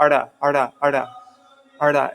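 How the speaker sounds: "arda" with a vowel before the tongue flap. Shown as a demonstration. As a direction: lead into "arda" with an uh. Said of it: "an uh before it".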